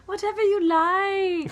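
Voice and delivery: high, slow coaxing voice; put-on high, accommodating voice; imitated massage worker. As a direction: draw it out, high and obliging. Imitating massage workers offering service.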